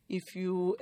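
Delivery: ordinary talk, nothing marked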